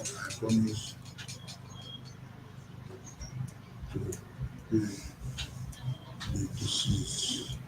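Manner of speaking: soft unvoiced muttering; whispering to self. Real, not acted. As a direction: mutter it while writing. Muttering while writing it down.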